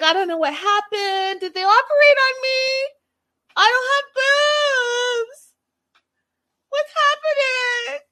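high, loud, tearful wail; mock freak-out. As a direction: wail it like a panicked patient groggy from anesthesia. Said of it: "She's freaking out".

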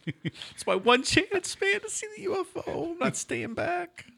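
high, slow, soft whiny put-on voice; mock pleading Ian. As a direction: beg like a kid denied the fun. Imitating Ian refusing orders.

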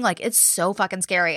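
ordinary delivery

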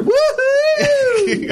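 long high party whoop; sky-high holler held long; high whooping woohoo. Retold or imitated. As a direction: let out a sustained, high "woohoo". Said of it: "How many times do you hear".

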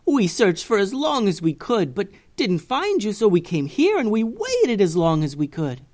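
aunt explaining to Bussy, emotionally; aunt's voice. Acted. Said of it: "said her aunt".

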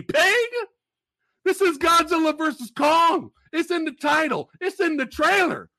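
high-pitched, hyped-up ranting; mock outrage. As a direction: scold the complainers in exaggerated indignation. Mock rant at plot complainers.

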